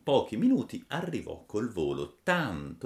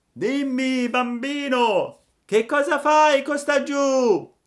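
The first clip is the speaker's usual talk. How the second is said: loud, high, long-drawn call; shouted from high above; 11 dB louder, slower than usual. A narrator's reading. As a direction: call down from a great height, stretching the words. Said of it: "gli gridò da una grande altezza".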